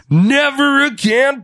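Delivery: high, slow, drawn-out outburst; mock scolding yell. Host voicing the boss warning a wrestler off, high mock yell. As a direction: imitate an over-dramatic final warning.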